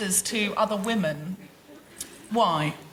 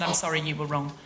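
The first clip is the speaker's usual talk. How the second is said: mock apology; 8 semitones lower, 4 dB quieter than usual. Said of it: "if I said".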